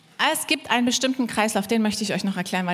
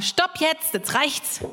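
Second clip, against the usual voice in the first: higher-pitched firm halt; firm love; 6 semitones higher, much slower than usual. Acted. Preacher voices love setting a limit, firm. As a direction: put your foot down. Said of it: "Liebe sagt auch mal".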